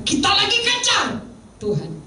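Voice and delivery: sudden high-pitched exclamation; urgent, high pulpit outburst; impassioned preaching. Real, not acted. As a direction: cry it out to the congregation.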